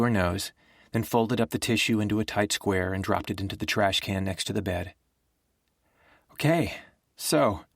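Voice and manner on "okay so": recovering from tears. A narrator's reading. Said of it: "her voice mostly recovered from crying".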